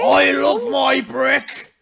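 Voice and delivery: high, very loud, drawn-out bellow; Father Ted impression. Co-host quoting Father Ted, slow bellowed impression. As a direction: roar it like a ranting comic Irishman. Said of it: "get me started on Father Ted".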